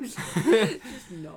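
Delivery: laughing. Real, not acted. Laughing dismissal.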